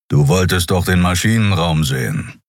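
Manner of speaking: ordinary delivery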